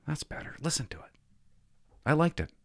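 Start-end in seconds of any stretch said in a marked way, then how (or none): 0.1-1.1 whispered "That's better" with no voice. Real, not acted. Host whispering "That's better" to himself, unvoiced. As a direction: breathe the words out, no voice.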